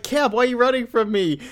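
put-on high, pleading kid voice; whiny kid. Rick begging Cap, high whiny kid voice. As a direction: plead up high like a hurt boy. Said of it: "he's like".

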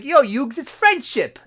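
mock Joey voice. Voicing Joey in retelling.

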